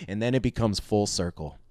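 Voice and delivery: ordinary delivery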